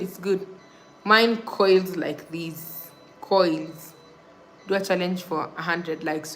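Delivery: usual speaking voice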